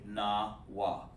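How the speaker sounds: "Nawa" drawn out low and soft, na, then wa; slow, broken down. Shown as a demonstration. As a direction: stretch "Nawa" into two separate, unhurried syllables. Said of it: "You said too quick".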